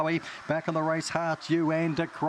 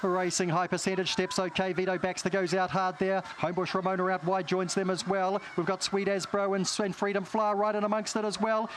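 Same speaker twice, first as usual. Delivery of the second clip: New Zealand race caller, climbing higher; broad kiwi accent; 5 semitones higher than usual. Acted. Race call, kiwi accent noted.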